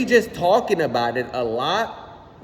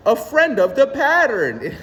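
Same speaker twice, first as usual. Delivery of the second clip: philosopher's voice; 6 semitones higher, 4 dB louder than usual. Retold or imitated. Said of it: "I have his voice in my head".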